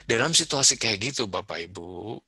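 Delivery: normal talking voice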